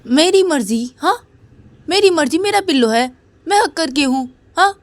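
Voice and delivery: little sister sulking over her pillow, defiant; stubborn child. Acted. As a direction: pout and claim the pillow. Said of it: "Uma बोलती है कि".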